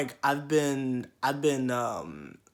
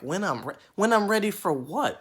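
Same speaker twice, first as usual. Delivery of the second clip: higher, louder rising disbelief; incredulous; 7 semitones higher, 5 dB louder than usual. Retold or imitated. Him answering the loc lady's advice, incredulous. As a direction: throw the question back in disbelief. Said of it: "And I'm like".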